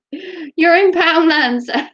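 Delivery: high, slow, drawn-out sarcasm; sarcastic. Pretend. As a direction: stretch it out, mockingly patient. Repeated with drawn emphasis.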